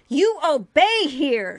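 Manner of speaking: slow, loud, high-pitched command; furious drawn-out yell. A narrator's reading. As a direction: yell it as an order, stretching each word. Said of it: "she yelled".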